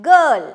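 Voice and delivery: put-on Girl said loud with a punched hard g; teacher models Girl with a hard g; hard g stressed. Shown as a demonstration. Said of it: "What is the spelling?".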